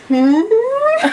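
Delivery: high questioning hum of a big creature; Ludo grunt. Retold or imitated. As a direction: hum it like a puzzled monster. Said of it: "Ludo's like".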